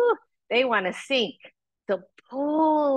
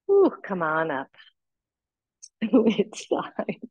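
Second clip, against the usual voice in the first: ordinary talk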